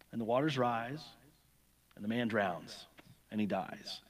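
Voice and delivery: usual speaking voice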